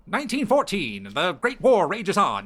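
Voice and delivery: high, loud vintage radio announcer; old-timey newsreader. Shown as a demonstration. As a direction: boom it like a 1910s newsreel. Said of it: "old timey news radio vo-, news reader voice".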